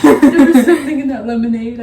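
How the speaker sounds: loud words breaking through laughter; laughing out loud. Real, not acted. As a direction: start in a loud laugh, then recover. Laughing fit in outtakes.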